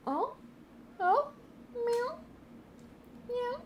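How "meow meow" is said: high, soft questioning meows at the cat; coaxing cat meow. Real, not acted. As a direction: meow gently up at the cat, asking. Meowing at her cat.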